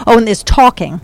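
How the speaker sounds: ordinary talk, nothing marked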